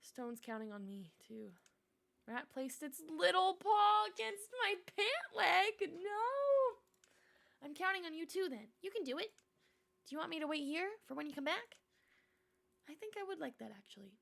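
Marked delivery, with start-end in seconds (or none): 2.3-11.8 high, loud eager little voice; excited little critter; squeaky rat. Acted. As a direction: cheer your friend on like a tiny rat. Rat cheers the player on.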